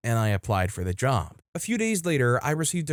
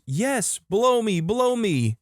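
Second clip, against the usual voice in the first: put-on high-pitched, eager little-kid voice; squealy mock toddler excitement; excited toddler; 10 semitones higher, slower than usual. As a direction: imitate an overexcited small boy begging. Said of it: "excited toddler jumping".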